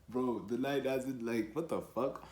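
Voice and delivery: usual speaking voice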